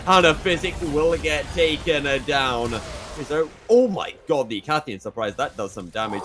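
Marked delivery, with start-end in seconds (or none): 0.1-4.5 much louder, pitch climbing, screaming the fight; rising shout into a slowed yell on Shurima shuffle; shouted play-by-play. Real, not acted. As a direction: roar the teamfight call as it peaks. Caster screams through teamfight.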